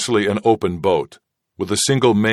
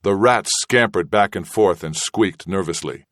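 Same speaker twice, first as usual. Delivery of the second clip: ordinary delivery, nothing marked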